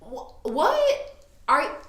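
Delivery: can't finish a word from shock; stammering shock. Retold or imitated. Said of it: "I couldn't get a full word out".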